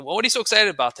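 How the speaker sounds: acting out an amazed questioner; retold eager question. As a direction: put the question with a lift in pitch and volume. Reporter questioning the dancing old man.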